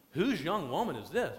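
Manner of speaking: put-on sing-song bounce on each word of "Whose young woman is this"; swooping, interested question; curious inflection. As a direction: lilt it like an intrigued admirer. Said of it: "he probably used the inflection in his voice".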